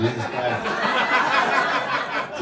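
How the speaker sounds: a long high disbelieving groan; drawn-out exclamation. Real, not acted. Comic startled at the time signal, drawn out. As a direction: stretch out Jesus Christ, high and slow.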